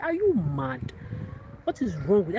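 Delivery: mock outrage at a foolish caller; exasperated retort. Retelling her retort to callers.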